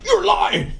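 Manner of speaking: high, loud cry of disbelief; accusing shout. Acted. Fan rebutting praise of the movie, accusing shout. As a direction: yell it as a comic accusation. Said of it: "Which I say".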